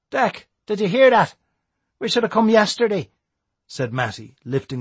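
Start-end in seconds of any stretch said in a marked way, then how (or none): 0.1-3.1 put-on excited young lad. Matty excited.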